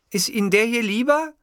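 high-pitched secretary voice. A narrator's reading. Secretary asking her boss about a ringtone, high character voice.

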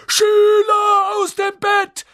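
very high, loud, drawn-out alarm yell; Peeves bellowing. A narrator's reading. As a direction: raise the alarm in a piercing poltergeist shout. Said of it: "brüllte Peeves".

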